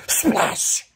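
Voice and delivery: a breathy hissed splash noise, high; imitated splash. Retelling the loudest splash ever, a whispered splash sound. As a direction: mimic the splash with air. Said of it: "That shit was like".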